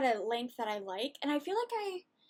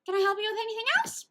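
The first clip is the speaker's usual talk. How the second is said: fake polite clerk; 10 semitones higher, 7 dB louder than usual. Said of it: "and I was just like".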